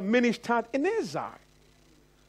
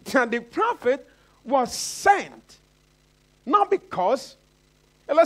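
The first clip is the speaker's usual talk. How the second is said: emphatic preaching; 4 semitones higher than usual. Acted. Preacher tells congregation prophets go unrecognised, emphatic preaching. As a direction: hammer each phrase home to the congregation.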